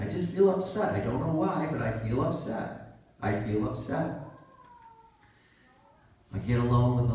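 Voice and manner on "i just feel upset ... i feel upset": higher, whiny upset voice; mock upset complaint. Preacher mimicking a troubled listener, lightly whiny. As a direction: play someone moping that they feel upset. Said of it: "You say".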